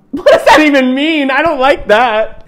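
high, loud, drawn-out complaint; appalled protest. Real, not acted. Reader reacting to kissed with entitlement, appalled. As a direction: wail in disbelief at the wording. Outburst at the book's wording.

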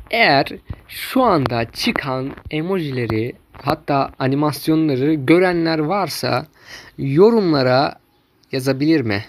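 ordinary talk, nothing marked

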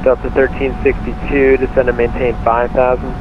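usual speaking voice

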